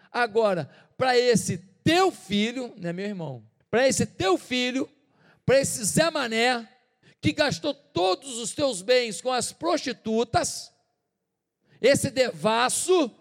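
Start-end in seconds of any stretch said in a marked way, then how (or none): 0.1-2.7 loud, slow, bitter emphasis on teu; jealous elder son confronting father, angry; resentful outburst. Acted. 3.7-13.1 loud, slow, bitter emphasis on teu; jealous elder son confronting father, angry; resentful outburst. Acted.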